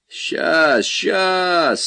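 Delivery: high, drawn-out sleepy call; hoarse with sleep. A narrator's reading. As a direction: call out as if just woken. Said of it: "сиплым со сна голосом проговорил он".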